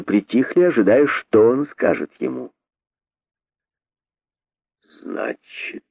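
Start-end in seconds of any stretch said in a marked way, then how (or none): 5.0-5.8 dark near-whisper; gloomy hushed. A narrator's reading. King gloomily addressing the guilty rabbit, hushed. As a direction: breathe it out grimly. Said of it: "мрачно обратился к нему король".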